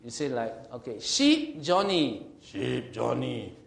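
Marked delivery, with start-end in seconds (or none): 1.0-2.3 high, loud, drawn-out call; stretched-out, raised calling tone; calling a sheep. Acted. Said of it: "he can even hear the intonation, the tone". 2.4-3.5 slow imitated sheep call, unraised; drawn-out call at an ordinary pitch; calling in another tone. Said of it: "he can even hear the intonation, the tone".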